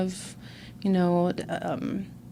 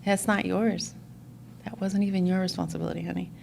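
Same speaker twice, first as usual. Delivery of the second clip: soft correction; 8 dB quieter than usual. Retold or imitated. Sponsor gently correcting her.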